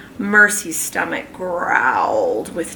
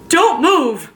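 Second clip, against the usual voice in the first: higher, louder urgent command; shouted; 9 semitones higher, 4 dB louder than usual. Acted. Mr Watson ordering his family to freeze, urgent shout. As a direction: bark it in alarm. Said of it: "shouted Mister Watson".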